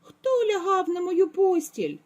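high, teary cub squeak; thin squeaky voice. A narrator's reading. Baby bear squeaks about his bed, high and teary. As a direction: squeak it with a little cry. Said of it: "запищав тоненьким голосом".

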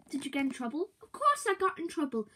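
normal talking voice

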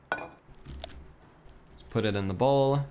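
usual speaking voice

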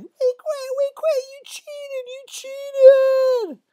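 very high, loud squealing wail; panicked kids' protest. Acted. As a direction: wail it like boys running away. Fleeing boys protest the magic.

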